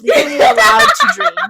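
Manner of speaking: loud, high, laughing correction. Real, not acted. Friend corrects the wording, loud and laughing. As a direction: correct her while cracking up.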